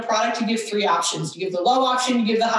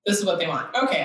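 ordinary delivery

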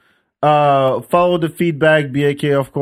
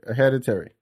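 usual speaking voice

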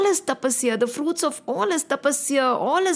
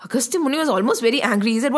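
ordinary delivery, nothing marked

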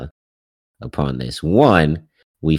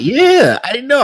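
much higher and louder, amazed; excited; 7 dB louder than usual. Real, not acted. Fan realizing the settlement had no name, excited. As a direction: blurt it out in disbelief.

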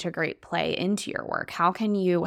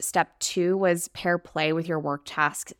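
normal talking voice

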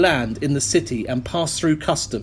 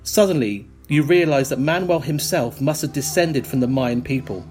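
ordinary talk, nothing marked

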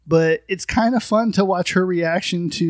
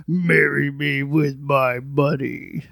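a hint of a slow muffled villain; garbled villain line; 2 semitones lower, much slower than usual. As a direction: drawl it like a dying villain. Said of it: "it sounded like he was saying".

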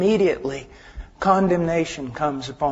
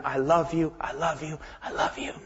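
soft, then softer, then breathed; fading to a whisper; 3 semitones lower, 7 dB quieter than usual. Acted. God calls to His children, fading into a whisper. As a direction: let each love you fade away.

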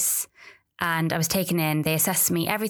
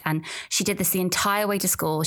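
ordinary talk

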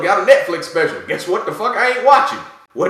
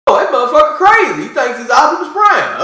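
high, loud incredulous yell; mock shout; 5 semitones higher, 5 dB louder than usual. To the guy who thinks he's Optimus Prime, a loud disbelieving yell. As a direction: shout it like you can't believe him. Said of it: "I go".

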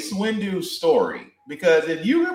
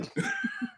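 squeaky burst of laughter; high squeal laugh; 10 dB quieter than usual. Real, not acted. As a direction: let a high laugh slip. Laughing at mispronunciation.

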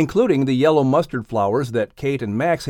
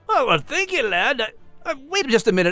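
high, cheery Scottish brogue; lilting Scottish accent, pitched high; Scottish terrier voice; 10 semitones higher than usual. Acted. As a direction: play a proud little Scottish dog. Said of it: "my favorite Scottish Terrier".

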